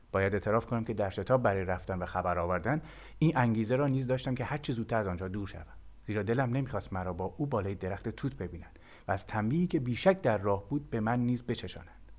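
ordinary delivery

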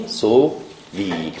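سووی as "so... vi", softly spaced out; soft, falling "so-vi" for سووی; shortened vowel. Shown as a demonstration. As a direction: say سووی slowly in two parts, short-o "so" then "vi".

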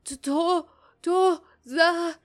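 high, loud, choked accusation; wheezing. A narrator's reading. Poisoned Glauk accusing the man with the tray, wheezing. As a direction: choke out each word slowly. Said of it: "خسخس کنان گفت".

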